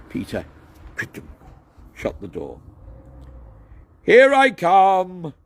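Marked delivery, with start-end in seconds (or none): 4.0-5.3 much higher, louder, slow call; shouted. A narrator's reading. Douglas shouting from the carriage, high, loud and slow. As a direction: announce it loudly from inside. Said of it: "shouted Douglas".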